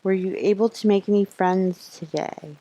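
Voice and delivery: ordinary delivery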